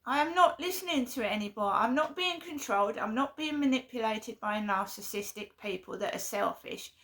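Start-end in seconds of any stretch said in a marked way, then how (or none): none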